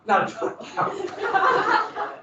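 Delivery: high, slow, laughing through the words; laughing correction. Real, not acted. Teacher correcting a misheard weight, laughing high and slow. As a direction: laugh it off as a silly joke. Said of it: "Pounds as in money".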